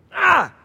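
high, loud cry of dismay; loud high groan of despair; anguished cry. Retold or imitated. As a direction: let out a pained yelp. Reacting to news of a new car.

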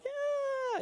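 very high happy yeah; cheery yeah. Retold or imitated. What lifters don't say, very high cheerful yeah. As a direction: chirp it with fake joy. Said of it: "it's not like they're like".

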